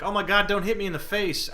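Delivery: high, loud pretend alarm; mock defensive panic. Gamer acts out cowering behind his Taunt card, mock panic. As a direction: yelp it like a hammed-up plea for mercy. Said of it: "I feel so defensive, like".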